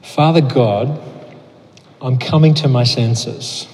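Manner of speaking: slow prayer, pausing after each line. Real, not acted. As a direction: pray it slowly, line by line, leaving room to repeat. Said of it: "I'll just pray it slowly".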